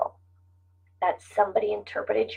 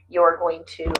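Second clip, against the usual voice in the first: ordinary talk, nothing marked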